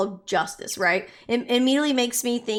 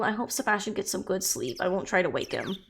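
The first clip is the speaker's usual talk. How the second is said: ordinary talk, nothing marked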